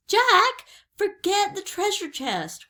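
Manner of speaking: high, insistent call, loud on the name; crying out. A narrator's reading. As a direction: call urgently to someone who won't come. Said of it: "cried Annie".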